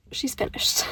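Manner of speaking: hushed, breathy announcement; whisper. Real, not acted. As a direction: whisper it like a secret. Announces finishing the book.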